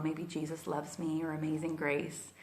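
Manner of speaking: ordinary delivery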